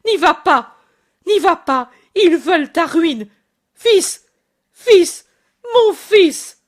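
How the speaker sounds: mother screaming to her son from the parapet; anguished cry. Acted. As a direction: scream after him from the parapet. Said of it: "lui crie".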